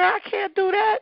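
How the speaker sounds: put-on high, pleading child voice; panicky little girl. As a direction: refuse in a scared kid voice. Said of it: "I said".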